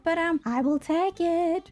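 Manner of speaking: drawn out high and slow in a put-on voice; milk the reluctance, slowly; grudging acceptance. Builder settling for a mascot instead of a plant, mock grudging.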